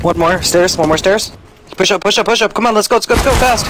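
rising, urgent team callouts. Real, not acted. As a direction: push them on, high and pressing. Urgent push callouts in a round.

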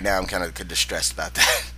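normal talking voice

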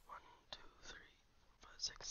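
counting in a breathy whisper under the breath. Real, not acted. Gamer counts the dinosaurs to himself in a whisper. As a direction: whisper the numbers to yourself as you count.

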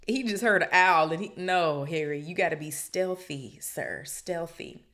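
loud, laughing disbelief; excited outburst. Real, not acted. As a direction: cut in loudly, half laughing.

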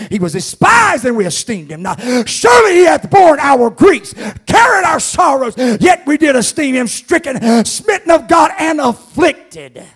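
high, loud preacher's shout, stretched at the end; preaching at full force. Acted. Preacher shouting Isaiah to the church, high and loud. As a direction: cry the scripture out over the church.

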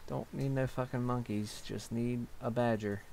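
usual speaking voice

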